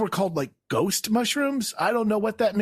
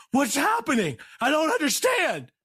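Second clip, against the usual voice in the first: high-pitched, bewildered wail; freaked-out, lost and high; mock panic; 9 semitones higher than usual. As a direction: cry out in hammed-up confusion. Acted panic at the strange music.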